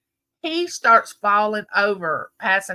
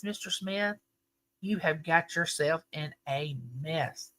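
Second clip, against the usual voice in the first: lightly voiced doctor, much softer; a hint of a grave doctor, hushed; doctor's verdict; 4 semitones lower, 9 dB quieter than usual. Doctor telling Mister Smith he's in a mess, in soft gravity. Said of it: "He says".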